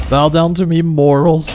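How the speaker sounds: booming mock villain boast; sneering wrestling villain. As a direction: gloat like a wrestling heel.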